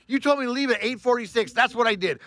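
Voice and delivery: high, indignant argument; defiant retort. Retold or imitated. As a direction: throw it back at the boss. Retold angry argument with boss.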